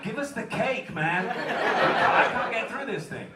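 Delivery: loud, high-pitched comic protest; mock exasperated plea. Dad overwhelmed by gingerbread cake, mock exasperated outburst. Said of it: "And I'm like".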